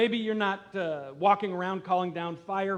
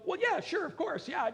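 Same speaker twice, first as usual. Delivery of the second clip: much higher, breezy brush-off of the question; mock casual agreement; 13 semitones higher than usual. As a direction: toss it off like it's obvious. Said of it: "before you go".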